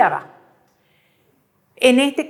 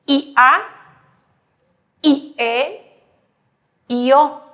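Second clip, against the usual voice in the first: loud spelled-out vowels; 4 dB louder, slower than usual. Shown as a demonstration. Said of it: "Fíjense".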